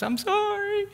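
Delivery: put-on high, small childish sorry; little girl apologizing. Daughter apologizing to her father, tiny high voice.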